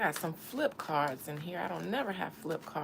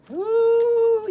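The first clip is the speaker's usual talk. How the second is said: high, loud woo; loud hoot; 13 dB louder than usual. Pretend. Psychic whooping at Leonard Larry's Carolina spirit, loud high hoot. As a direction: let out a hoot of amazement.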